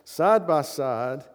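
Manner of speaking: side by side drawn out very slowly; slow right down on side by side; slow emphasis. Shown as a demonstration. Preacher dwells on side by side, very slow.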